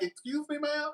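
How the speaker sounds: high, soft puzzled question; bewildered excuse me; confused. Retold or imitated. Confused polite reply.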